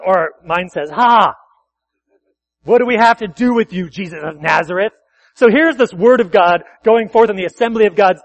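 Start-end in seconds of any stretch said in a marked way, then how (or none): 0.9-4.9 loud startled demon cry. Acted. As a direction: recoil and cry out loudly. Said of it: "he cried out with a loud voice".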